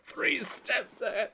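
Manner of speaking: slow, high, soft gloating line; soft smug catchphrase. Retold or imitated. Quoting a cartoon villain again, soft smug and slow.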